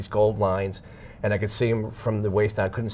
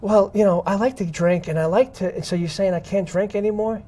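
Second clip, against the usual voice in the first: higher-pitched retold protest to God; mock pleading argument; 9 semitones higher than usual. New believer bargains with God over drinking, retold pleading argument. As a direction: haggle lightly, voice pitched up. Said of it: "and I'm arguing with God".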